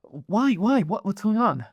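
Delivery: alarmed questioning. Acted. Pyra questioning her mentor's sudden order, alarmed. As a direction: demand to know, voice lifting in alarm. Startled apprentice answers urgent order.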